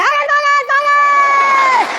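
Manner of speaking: very high, loud mock scream; imitated crowd screaming. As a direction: scream it like a drunken crowd, holding the last word. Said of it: "People are screaming".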